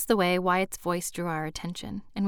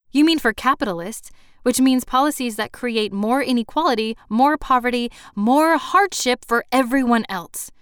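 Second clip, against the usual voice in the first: loud, raised voice, hammering each item; indignant rebuttal; 4 semitones higher, 8 dB louder than usual. Acted. Countering the host's praise of the economy, indignant. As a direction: pile up the charges heatedly.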